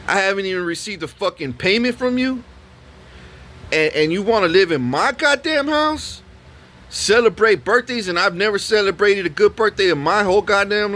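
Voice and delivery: unload every grievance, voice way up; re-enacted high-pitched tirade, slowing on the last insult; furious rant. Retold furious rant at tenant.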